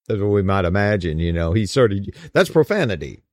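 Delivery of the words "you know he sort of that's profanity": normal talking voice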